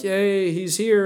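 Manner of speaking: relieved crowd's happy "yay"; cheering. Acted. Preacher voices captives seeing their rescuer, cheering.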